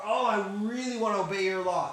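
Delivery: high, loud mock rapture; eager David. Teacher quotes David's verse thirty-four, hammed-up eagerness. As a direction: gush it like an overjoyed psalmist. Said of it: "This is literally how he talks".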